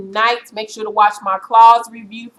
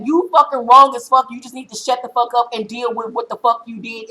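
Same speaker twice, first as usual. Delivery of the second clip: ordinary talk, nothing marked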